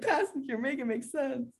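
soft, high-pitched mock plea. Mock plea to the absent singer.

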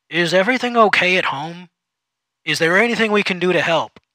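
concerned. Acted. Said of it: "seeming concerned".

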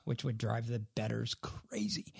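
a quick whispered aside; whispering. Real, not acted. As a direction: drop to a whisper for the bettors. Aside about bettors.

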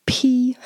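whispered P as a burst of air; hard P. Shown as a demonstration. Teacher whispering the hard English P to show its puff. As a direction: push out a hard aspirated P. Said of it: "that hard".

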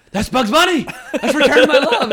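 loud, high-pitched excited shout; thrilled recognition. Retold or imitated. Fan recognizing cartoon music in the film, excited shout. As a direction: burst out in laughing delight.

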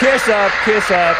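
loud, high mocking taunt; taunting jeer. Real, not acted. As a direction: jeer at him like a schoolkid. Taunting the clip's speaker.

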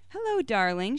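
mother back home, all sugar; sing-song sweetness. Acted. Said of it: "her voice full of sing-song sweetness".

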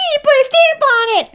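squeaky high-pitched mimic. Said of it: "That's what it sounds".

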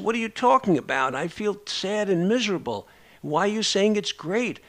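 high, incredulous protest; indignant disbelief. Retold or imitated. Therapist retells patient's baffled protest, high and incredulous. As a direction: voice rising in outraged disbelief.

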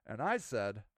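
ordinary talk, nothing marked

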